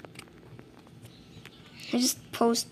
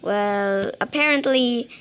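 ordinary talk

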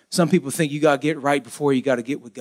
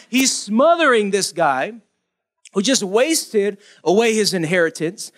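ordinary delivery

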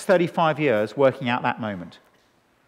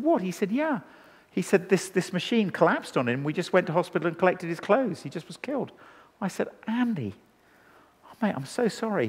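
much softer, stunned what and sorry; hushed shock; 7 dB quieter than usual. Retold or imitated. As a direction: drop to a hush as the news lands. Retelling a friend's brother's death.

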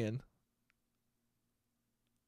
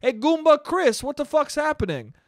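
mock taunting heckler; 8 semitones higher than usual. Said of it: "imagine if he was like".

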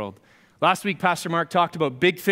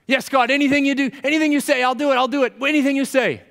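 high, frantic promises; desperate agreeing; 9 semitones higher, 4 dB louder than usual. Acted. Jack promises God he will obey, desperate agreeing. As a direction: agree to anything, pleading from above a drop.

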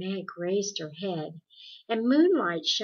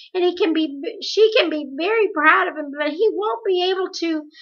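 higher and louder, pushing past held-back tears; speaking over a sob; 7 semitones higher, 9 dB louder than usual. Acted. Meg tells Calvin about her mother's search, holding back a sob. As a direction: fight the sob and get the words out. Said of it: "choked down a sob, managed to speak over it".

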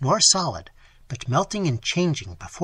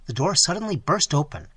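ordinary delivery, nothing marked

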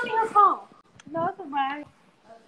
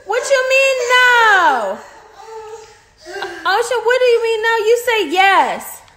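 loud scolding; 4 semitones higher, 5 dB louder than usual. Real, not acted. Mom scolding toddler.

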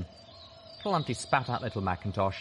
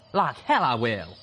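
loud, heated refusal; defiant shout; 3 semitones higher, 6 dB louder than usual. A narrator's reading. Armed doppelganger refuses Skydive's order, defiant shout. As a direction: throw the order back loudly. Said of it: "the Calamity doppelganger shouted".

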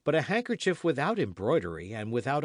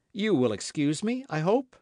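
high, louder, eager request; earnest young man's appeal; hopeful apology; 7 semitones higher, 5 dB louder than usual. A narrator's reading. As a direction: ask pardon with the voice lifting. D'Artagnan apologises to Aramis.

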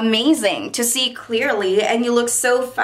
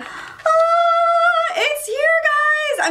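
high sung squeal, drawn out slowly; excited squeal; much slower than usual. Real, not acted. Vlogger squealing to viewers as the glasses arrive, excited. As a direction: sing it out, thrilled the package is here.

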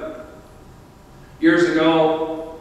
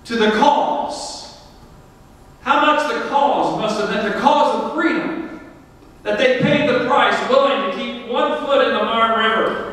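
higher-pitched, rousing sermon delivery; fervent preaching; 7 semitones higher than usual. Real, not acted. Pastor honoring soldiers who held the river, fervent preaching. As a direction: drive the lesson home with fervor, pitched up.

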